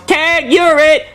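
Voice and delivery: put-on high, slow, drawn-out taunting kid; kid yelling tag. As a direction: sing it out like a bratty kid. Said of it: "he yells".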